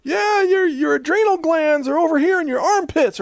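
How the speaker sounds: high, loud childish mock doctor; kid playing doctor. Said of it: "It's like".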